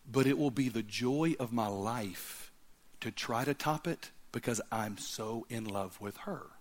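a hint of the groom's heartfelt answer; tender.

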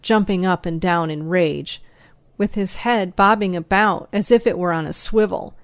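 ordinary delivery

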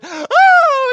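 loud, very high imitated wail; mock child's wail. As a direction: wail like an upset small child. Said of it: "she started crying into the pillow".

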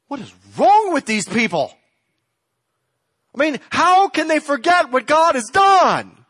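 high, loud, disbelieving rant; exasperated outburst. Retold or imitated. Bible reader fed up with Israel's forgetting, exasperated.

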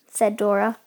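plain reading voice

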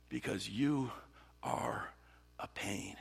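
"you... are... a pain" with long pauses, fading; word-by-word exasperation. Acted. David repeating his complaint in prayer, pointedly spaced out. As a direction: drop to a near whisper by "a pain".

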